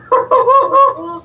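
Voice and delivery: very high, loud unhinged cackle; maniacal laugh. Acted. Wrestler mocking P T before attacking, maniacal laugh. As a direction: laugh like a madman savoring the threat. Villain laugh mid promo.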